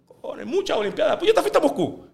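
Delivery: a mock boast to an old colleague, voice jumping high; teasing high exclaim. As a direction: rib your friend with a high-pitched jab. Teasing friend about old coverage.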